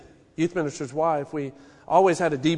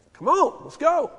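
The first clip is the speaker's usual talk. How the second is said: higher call urging an animal on; 9 semitones higher than usual. Retold or imitated. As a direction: prod the beast forward. Driving an animal with a stick.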